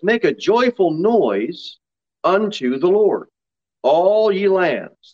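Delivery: slow, drawn-out psalm reading; scripture reading. Real, not acted. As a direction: read it out to the congregation, stretching the key words. Reads the psalm aloud.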